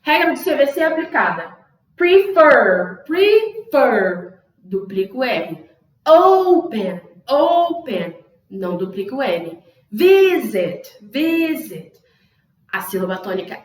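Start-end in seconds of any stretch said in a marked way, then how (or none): 2.0-4.4 "Prefer" slowly, with a long, strong FER; stressed syllable shown. Shown as a demonstration. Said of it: "falar a palavra em voz alta e pensar". 6.1-8.2 "Open" with a high, loud, stretched O; stressed syllable shown. Shown as a demonstration. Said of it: "falar a palavra em voz alta e pensar". 9.9-11.9 "Visit" with a long, high VI and falling zit; stress on VI. Shown as a demonstration. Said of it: "Não, é o vi".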